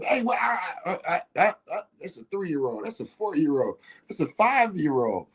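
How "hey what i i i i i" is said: high, sharp scolding outburst; stern scolding shout. Retold or imitated. Parent snapping at a small child, scolding shout. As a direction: snap at the kid, then stammer off. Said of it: "every time I give".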